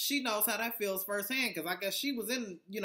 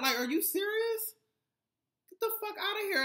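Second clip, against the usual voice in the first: much higher and louder, exasperated; outraged rant; 5 dB louder than usual. Real, not acted. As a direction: blast him in disbelief. Ranting at Mendeecees over phone call.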